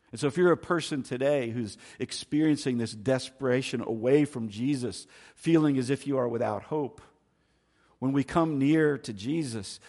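loud, driving sermon voice; full-force preaching. Acted. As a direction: preach it out to the whole room. Preaching hope near Jesus.

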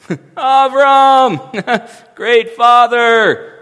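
a heckler's loud mocking sing-song; mocking smart aleck. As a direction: taunt him like a schoolyard wise guy. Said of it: "the jokes that he has to hear again and again from the smart Alex".

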